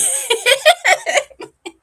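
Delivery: high, falling laugh. Real, not acted. Woman laughing about farewell to the Virgin, high laugh. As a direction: laugh up high and let it fall.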